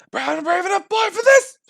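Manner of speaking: high, loud brave cry; brave shout. Acted. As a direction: shout it to pump yourself up. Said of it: "A brave boy".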